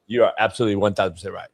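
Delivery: low, soft and fast; sarcastic surrender; mock contrite. Real, not acted. Conceding to co-host, mock calm hushed.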